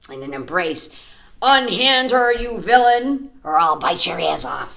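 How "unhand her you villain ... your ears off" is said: loud heroic bone; bone's threat. A narrator's reading. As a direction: command the villain boldly. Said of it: "said the magic bone".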